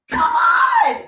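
very high, loud cry of protest; exasperated scream. Real, not acted. Fan losing their cool at the screen, exasperated scream. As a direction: shriek it in real frustration. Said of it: "wondering why I'm yelling".

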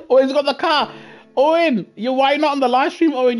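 high, delighted cry to a friend; real excited outburst. Real, not acted. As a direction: burst out, thrilled to see his work. Streamer thrilled as friend's car appears.

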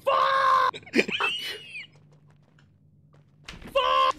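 loud screamed swearing; freak-out. Real, not acted. Player cursing a disaster in the game, screamed. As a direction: scream the curse in despair. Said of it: "Someone clip his freak out".

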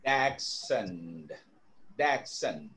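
Dachshund drawn out as DAAK-sund, pitched low; careful pronunciation. Shown as a demonstration. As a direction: repeat Dachshund slowly for a learner, syllable by syllable. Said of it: "That's how it is pronounced".

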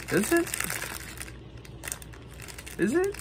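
sudden high, doubting squeak; surprised doubt. Real, not acted. Unsure whether the figure is Hammy, in a high doubting voice.

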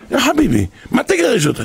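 high astonished protest; shocked disbelief. Retold or imitated. Speaker recalling his own shock at the order, astonished. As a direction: react in shock.

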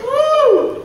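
loud, high whoop; loud high party whoop. Real, not acted. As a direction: let out a big excited woo. Whooping at the crowd.

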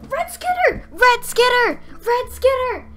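very high, loud triumphant screaming; real joy at a rare catch; ecstatic shrieks. Real, not acted.

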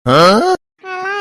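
a very loud, long-stretched "ಅಲ್ಲ"; loud drawn-out cry. Acted. The old woman bursts out loudly and drags out "ಅಲ್ಲ". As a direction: hold the word out in loud outrage.

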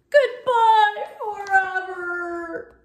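hammed-up sob of a goodbye; mock tearful goodbye. Shark bidding a dramatic farewell, mock crying. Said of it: "says the shark".

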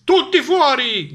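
high, loud, drawn-out call; farmer's shout. A narrator's reading. Farmer calls the animals out of the barn, shouting. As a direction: call everyone out with full voice. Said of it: "gridò".

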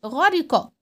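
snatch the i of غرقة quickly; غرقة with a brief kasra; short i in غرقة. Shown as a demonstration. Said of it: "دي بسرعة اهي".

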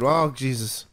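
ordinary talk, nothing marked

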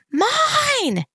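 put-on very high, loud toddler screech. A narrator's reading. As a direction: screech with all her lungs. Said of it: "screeched Boots".

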